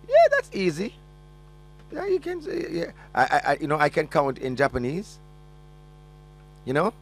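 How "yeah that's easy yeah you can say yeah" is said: very high teasing falsetto; high mock voice. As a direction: tease him in a squeaky falsetto. Co-host teasing about Chinese.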